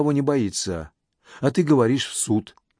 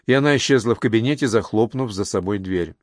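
plain reading voice

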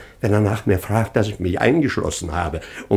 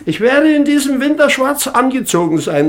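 shrieking, far higher and louder; hysterical lament; 7 dB louder than usual. Acted. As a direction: wail it in comic despair. (hysterisches Klagen, schrill und laut)

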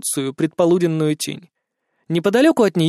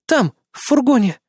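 urgent; 7 semitones higher than usual. Acted. Frightened woman answers urgently.

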